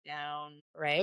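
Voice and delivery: low, soft register; going down low. Shown as a demonstration. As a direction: let the voice sink right down. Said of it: "if you like to go down".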